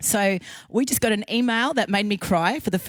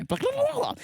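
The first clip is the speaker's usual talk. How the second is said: high, sing-song blah blah; mock blah; 3 dB quieter than usual. Real, not acted. Host skipping a long business name, teasing. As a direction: toss it off playfully, up high. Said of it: "I do that once a week".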